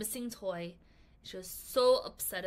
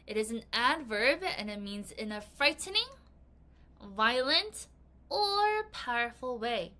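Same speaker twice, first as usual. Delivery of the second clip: ordinary delivery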